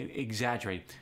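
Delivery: ordinary talk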